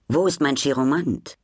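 plain reading voice

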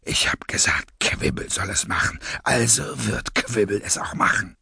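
low, breathy, measured menace; cold masked villain. Acted. Masked leader calms his gang, low and breathy. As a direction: soothe condescendingly in a low villain voice.

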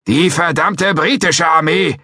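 high, loud, slow comeback; defiant retort. Acted. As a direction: fire it back in unison. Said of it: "konterten McCracken und ich unisono".

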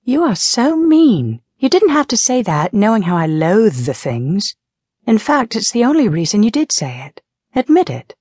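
high-pitched, indignant British scolding; indignant Patience. A narrator's reading. Patience scolds Ariana for scaring her, high and indignant. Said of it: "breathing hard".

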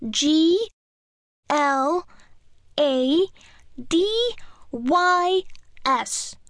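put-on child voice, letters spelled out; little girl spelling. A narrator's reading. Jane spells her name.

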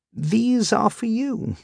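high, slow, tentative offering; placating offer. Acted. Lodger handing landlady a nosegay, high placating voice. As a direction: offer it gingerly, drawn out and high.

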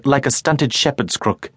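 ordinary talk, nothing marked

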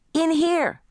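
high-pitched answering holler; daughter's shout. A narrator's reading. Daughter calling back to mother, high shout. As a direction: call back from another room. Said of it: "shouted my daughter".

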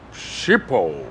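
Schiphol low and soft as SHEE-pol; Dutch pronunciation. Shown as a demonstration. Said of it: "does the very name smack of adventure".

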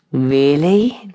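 drawn-out innocent question. A narrator's reading. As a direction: ask it with mock innocence. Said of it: "Chase asked innocently".

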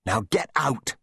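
high, restrained shout; shouted order. A narrator's reading. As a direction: order someone away urgently. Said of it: "shouted Gatty".

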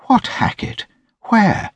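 high-pitched puzzled questions; lady's voice, leaping high on the question. A narrator's reading. Lady asking the gentleman about Hackett, puzzled.

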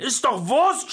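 high, loud, irate yell; shouting. A narrator's reading. Jörg yelling at grandma on the phone, shouting. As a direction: bellow it down the phone. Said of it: "schrie er".

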